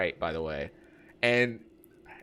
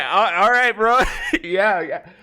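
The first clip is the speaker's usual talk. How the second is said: laughing through the words, higher and louder; 8 semitones higher, 5 dB louder than usual. Real, not acted. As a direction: give in while cracking up. Laughing at friend's stubborn choice.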